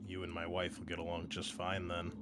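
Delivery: ordinary talk, nothing marked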